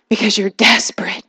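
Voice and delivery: dramatic whispered aside. Acted. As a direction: hush it to make the point. Preacher drops to a whisper.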